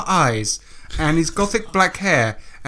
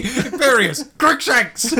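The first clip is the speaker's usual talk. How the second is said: high-pitched mock shout through laughter; giggly imitation of someone calling out; mock random shouting; 10 semitones higher, slower than usual. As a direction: blurt it like random words, laughing. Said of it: "you have to shout a random word".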